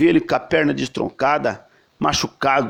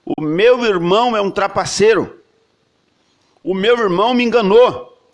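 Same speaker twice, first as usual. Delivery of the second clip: Esau's complaint; 4 semitones higher than usual. Retold or imitated. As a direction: spit out the grievance of a cheated brother. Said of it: "dizendo assim".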